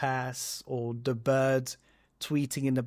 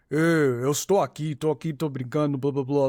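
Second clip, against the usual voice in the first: put-on deep, louder Angolan-accented Portuguese; deep Angolan crab; 4 dB louder than usual. Imitating the Angolan crab from the dub, deep Angolan accent. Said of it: "like, very deep voice".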